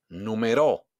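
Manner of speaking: "numeró" punched on the last RÓ; stress on final syllable. Shown as a demonstration. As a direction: land hard on RÓ in "numeró". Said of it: "hago un golpe de voz". ("numeró" con golpe de voz en RÓ)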